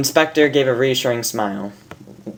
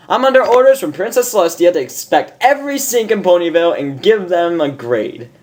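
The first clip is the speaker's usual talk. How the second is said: higher, louder official bluff; fake inspector; 5 semitones higher, 3 dB louder than usual. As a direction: bluff your authority. Disguised inspector voice.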